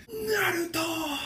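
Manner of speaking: long, high-pitched drawn-out yell; anime power-up cry. Acted. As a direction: stretch the name into a high battle cry.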